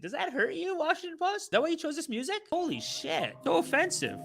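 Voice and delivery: teasing the Washington Post in high false sympathy; mock pity taunt. Real, not acted.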